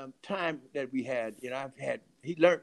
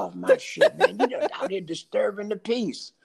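higher, amused take on his cussing; 6 semitones higher, 5 dB louder than usual. Retold or imitated. Dead trumpeter telling friend off, hammed-up imitation. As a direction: lightly imitate his complaint through laughter. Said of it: "that's the way he talks".

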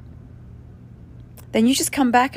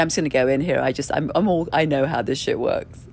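imitating smug friend; 6 semitones lower than usual. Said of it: "she's thinking".

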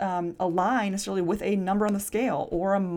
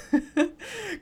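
high bright burst of laughter; amused laugh; 12 semitones higher than usual. Real, not acted. Host laughing at her buying-a-new-car joke. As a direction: laugh at your own joke.

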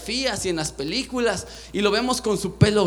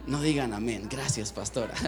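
laughing aside; 10 semitones lower, 8 dB quieter than usual. Real, not acted. Jokes after calling himself crazy.

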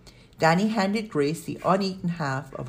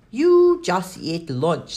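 slow, loud, drawn-out scold; indignant housekeeper's telling-off; 4 semitones higher, 7 dB louder, much slower than usual. A narrator's reading. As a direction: draw the words out in exasperated reproach. Housekeeper scolds snacking child, swats hand.

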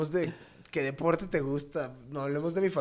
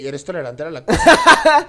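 much louder, laughing through the question; laughing tease; 16 dB louder than usual. Real, not acted. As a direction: burst out laughing as you ask it. Teasing callback, laughing.

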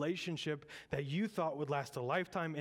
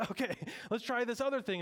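higher, fast mock prophet voice; whiny prophets; 8 semitones higher, faster than usual. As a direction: rush it like a frantic prophet. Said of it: "These prophets are saying".

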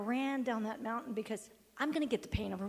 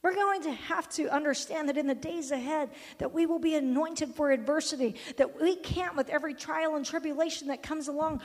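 raised preaching voice, building louder; full-force preaching; 4 semitones higher, 7 dB louder than usual. Real, not acted. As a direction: build to a loud, high sermon climax.

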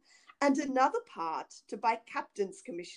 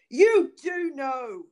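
slow, louder, higher-pitched accusation; stern accusation; 5 semitones higher, 10 dB louder, slower than usual. A narrator's reading. Bluebeard confronts his wife over the key, stern accusation. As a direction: press each word hard at the culprit.

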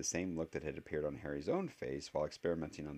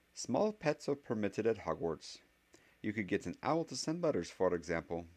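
higher-pitched professor voice, explaining; stern witch professor; 5 semitones higher than usual. Acted. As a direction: voice the professor listing the rules.

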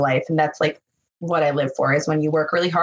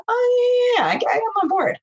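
excited. Retold or imitated. Retold eager reaction.

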